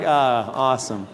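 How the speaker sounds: low, slow, dry sarcastic awesome; deadpan sarcasm. Retold or imitated. Injured pastor greeted by fan paramedic, dry low sarcasm. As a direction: say it flat and unimpressed. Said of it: "I'm like".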